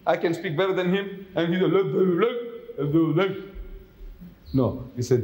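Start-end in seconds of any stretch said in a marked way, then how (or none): 1.7-3.4 "Let there be light" mumbled with lazy, gummy consonants, half laughing; mock toothless speech. Preacher mocking a toothless God for the crowd, slurred and gummy. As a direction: mumble it as if you had no teeth. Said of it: "If He's got a mouth and no teeth".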